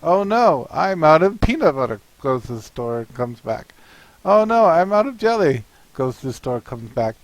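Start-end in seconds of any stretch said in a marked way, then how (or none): 0.0-2.0 higher and louder comic dismay; a cartoon dinosaur wailing at an empty cupboard; dismayed Stegosaurus. Stegosaurus finds no bread, hammed-up dismay. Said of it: "our Stegosaurus says". 4.2-5.6 higher and louder comic dismay; a cartoon dinosaur wailing at an empty cupboard; dismayed Stegosaurus. Stegosaurus finds no bread, hammed-up dismay. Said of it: "our Stegosaurus says".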